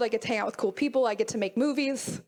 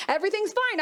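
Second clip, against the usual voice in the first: high, sing-song forced cheer; fake cheerful imposter; 8 semitones higher than usual. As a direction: mask the stress with a bright smile. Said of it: "I've got these two voices".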